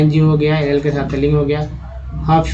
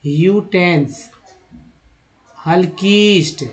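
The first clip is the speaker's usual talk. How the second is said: ordinary talk, nothing marked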